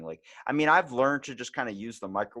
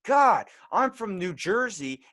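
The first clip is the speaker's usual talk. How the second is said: high, slow, louder Jersey whine; mock Jersey woman; 10 semitones higher, 5 dB louder, slower than usual. Imitating the Jersey woman at the pump, whiny. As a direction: drawl it like a Jersey local.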